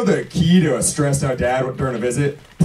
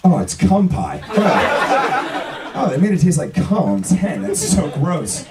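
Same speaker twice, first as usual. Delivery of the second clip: higher-pitched mock child's dismay; disgusted little kid; 5 semitones higher, much slower than usual. Little kid tasting a nasty jelly bean, mock disgust. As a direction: play a little kid grossed out by a candy. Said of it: "I'm a little kid".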